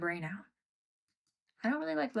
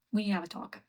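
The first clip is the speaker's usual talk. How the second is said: ordinary delivery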